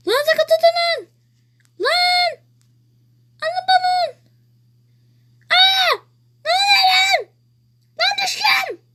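very high, loud screams; panicked screaming. Real, not acted. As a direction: scream in a panic while falling. Falling off the balloon.

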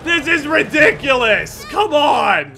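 laughing outraged shout; berserk rage. Real, not acted. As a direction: yell in disbelief, laughing.

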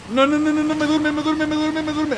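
high, frantic string of no's; panicked pleading. Real, not acted. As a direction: panic as the sleep hits. Monster putting player to sleep.

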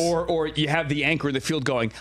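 ordinary talk, nothing marked

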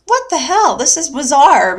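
high, retold burst of disbelief; baffled exclamation. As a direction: exclaim it, retelling your own bafflement. Retells own baffled alarm.

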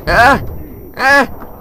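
real panicked yells, high and loud; screaming for your life as enemies grab you. Real, not acted. Player on fire, screaming in real panic at teammates.

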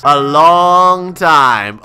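very slow, loud announcement; drawn-out taunt. Acted. Cop answering inmate about his court date, drawn-out. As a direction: stretch each word right out, loudly.

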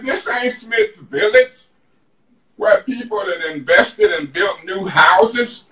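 usual speaking voice

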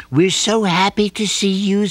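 a hint of mama bee; lightly voiced Mama Nz. A narrator's reading. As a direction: welcome him warmly. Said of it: "said Mama Nz".

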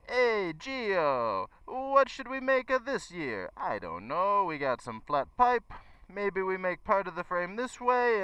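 high, hammed-up Italian accent; terrible Italian accent. [name] imitates a Bimota engineer, hammed-up Italian accent. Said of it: "sorry for the terrible accent".

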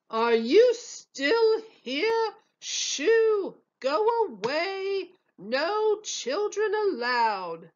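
high, slow, loud shoo; shooing shout. A narrator's reading. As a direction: chase the kids out, stretching the words. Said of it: "said the head waiter".

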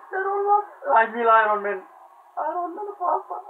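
high-pitched begging; pleading. Real, not acted. Gamer begging Iron Man for mercy, pleading.

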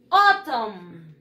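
AUTUMN stressed at the start, then falling away; model pronunciation. Shown as a demonstration. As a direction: say "Autumn" slowly for pupils to copy. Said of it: "Let's practice again the pronunciation". (pronunciación modelo, alargada)

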